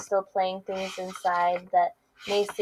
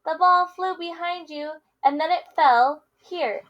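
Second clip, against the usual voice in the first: lively picture-book read-aloud with character voices; reading to children; 5 semitones higher, 6 dB louder than usual. Acted. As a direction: read it to kids, playing both friends. Teacher reads picture book aloud.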